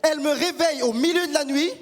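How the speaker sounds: normal talking voice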